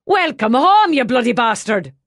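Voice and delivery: loud, high hearty shout across the pub. A narrator's reading. Brian hails his old friend and calls to the band, shouting. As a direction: bellow it out in welcome. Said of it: "he shouted to the musicians".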